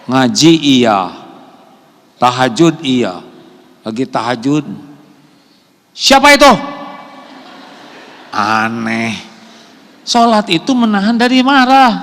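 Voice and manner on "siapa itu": high, loud startled "who's that?"; startled shout. Retold or imitated. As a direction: jump at a noise in the night. Istri kaget saat tahajud. (teriakan kaget)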